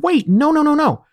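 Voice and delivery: high, quick interrupting protest; urgent objection. Retold or imitated. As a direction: cut in with a rapid high string of refusals. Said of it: "I stopped her and said".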